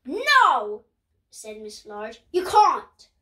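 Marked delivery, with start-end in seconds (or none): 0.0-0.8 firm refusal. Acted. Mum refuses the children. 2.3-3.1 firm refusal. Acted. Mum refuses the children.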